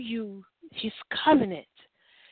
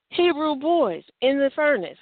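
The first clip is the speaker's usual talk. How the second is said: ordinary delivery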